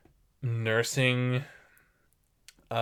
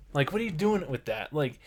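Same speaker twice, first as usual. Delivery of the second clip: ordinary talk, nothing marked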